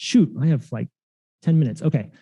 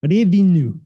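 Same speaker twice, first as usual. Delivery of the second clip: Russian-accented "revenu." as a loan word; 3 semitones higher, 4 dB louder than usual. Shown as a demonstration. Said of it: "That was my best Russian accent I could".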